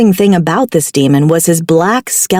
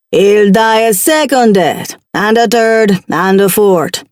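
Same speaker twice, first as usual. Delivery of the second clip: put-on Jamaican accent. Demon threatens slowly.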